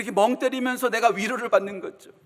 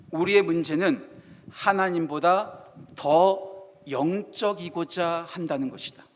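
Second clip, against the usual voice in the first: slow quote; 4 semitones lower, slower than usual. Shown as a demonstration. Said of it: "잘 들어보세요".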